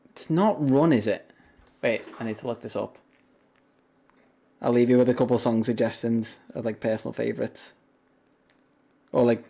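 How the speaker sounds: ordinary talk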